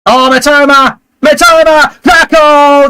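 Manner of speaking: real commentator excitement; excited shout. Real, not acted. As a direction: yell his name as he wins it.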